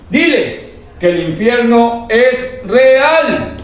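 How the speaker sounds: drawn-out high climactic proclamation; preaching climax. Retold or imitated. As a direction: preach it at the peak of intensity. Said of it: "la voz del Espíritu Santo que me dijo".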